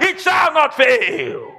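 "It shall not fail" very slow and shouted, "shall" stressed; shouted finale. Shown as a demonstration. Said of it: "come to pass".

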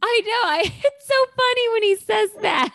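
loud, high, laughing agreement; amused delight. Real, not acted. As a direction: burst out laughing while talking. Delighted reaction to the quoted line.

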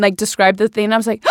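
ordinary talk, nothing marked

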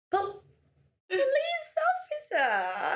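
high, slow, wheedling plea; pleading puppy eyes. Retold or imitated. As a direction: beg the officer sweetly with wide eyes. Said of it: "blinking your eyes".

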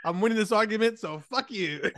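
high, cocky comic comeback; mock triumphant. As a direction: play the sore winner, hammed up through laughter. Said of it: "You shoulda did the whole".

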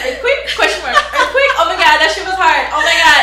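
high, loud laughing quote. Retold or imitated. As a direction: laugh through the words. Laughing while quoting tweets.

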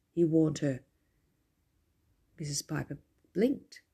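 plain reading voice

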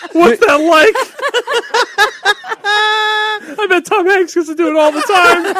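high, loud, laughing boast; high bragging voice. Acted. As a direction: brag it up like a show-off.

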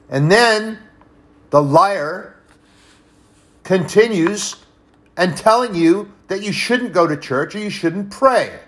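high, loud impassioned preaching; rising revival preacher's cry; preaching at full force. Real, not acted. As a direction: build the fire of the sermon.

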